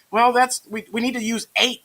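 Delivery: high-pitched eager pupil imitation; high, halting student reply. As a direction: play a kid offering an answer, pitched high. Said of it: "They're going".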